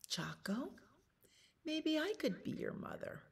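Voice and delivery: hushed, high mother bear; tender offer. A narrator's reading. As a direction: offer it very softly to the little bird.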